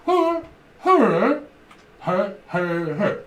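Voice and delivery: high, loud mock-accent hellos; Polish shopper impression. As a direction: call out hello like a shopper at the till. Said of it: "they come in and they go".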